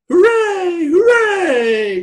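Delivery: very high, loud hooray; cheering cry. A narrator's reading. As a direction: cheer in a squeaky, drawn-out shout. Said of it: "cried the little clown and all".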